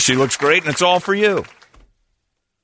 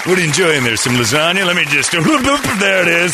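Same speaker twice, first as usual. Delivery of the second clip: sleazy voice; 6 semitones higher, 5 dB louder than usual. Retold or imitated. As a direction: lean in like a creep. Acted flasher at lunch.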